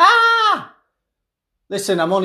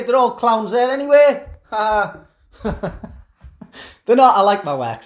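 ordinary delivery

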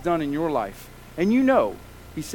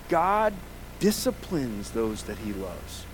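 ordinary talk

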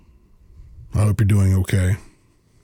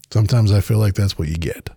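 ordinary talk

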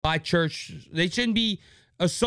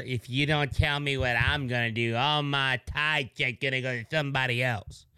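low, soft, sulky churchgoer voice; mocking churchgoer; 9 semitones lower, 4 dB quieter than usual. Host mimicking churchgoers threatening their pastor, low sulky grumble. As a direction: grumble it low like a spoiled congregant. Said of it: "tells the pastor".

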